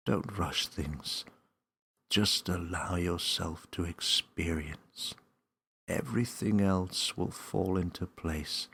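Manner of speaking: put-on gently calm an eager child; calm wise voice. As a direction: wise voice urging Millie not to rush. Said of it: "a strong but calming voice".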